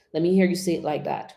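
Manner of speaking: ordinary talk, nothing marked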